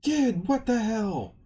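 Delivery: high, loud, drawn-out outburst; angry yell. Retold or imitated. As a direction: yell it indignantly, stretching the words. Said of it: "Luffy is then yelled at".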